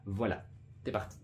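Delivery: ordinary delivery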